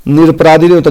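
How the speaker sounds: teacher hits नहीं hard; stress on नहीं. Shown as a demonstration. Teacher correcting a student's wrong word, stressed. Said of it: "निरपराध होता है".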